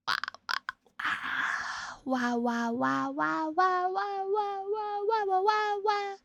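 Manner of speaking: rising high mock wah wah; mock baby wail. Rising silly wailing sound.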